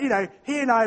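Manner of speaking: usual speaking voice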